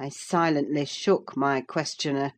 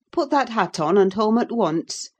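stern order; 5 semitones higher, 4 dB louder than usual. A narrator's reading. Nurse ordering girl home.

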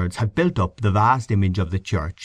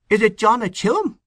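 high-pitched, incredulous query; astonished question; 14 semitones higher than usual. A narrator's reading. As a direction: send the voice right up in surprise. Astonished question after crying "What?.

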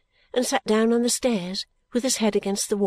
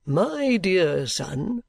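very slow, grandly drawn-out address; self-important patriarch; pompous old father; much slower than usual. A narrator's reading. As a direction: bestow each word like a blessing.

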